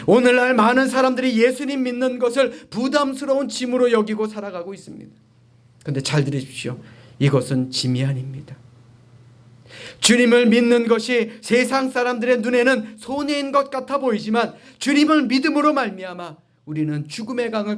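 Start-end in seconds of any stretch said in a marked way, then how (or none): 0.1-5.1 high, insistent pulpit voice; emphatic preaching. Real, not acted. Preacher on faith felt as burden, emphatic preaching. 10.0-17.8 high, insistent pulpit voice; emphatic preaching. Real, not acted. Preacher telling congregation of faith's gain, emphatic preaching.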